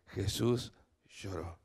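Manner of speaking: solemn whisper-soft reading of the verse; tender, hushed. Acted. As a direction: let the verse fall gently and slowly.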